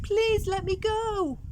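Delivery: high, slow, drawn-out begging; tired beast begging Chameleon for freedom; pleading. Acted. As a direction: whimper it out like a worn-out animal. Exhausted animals begging.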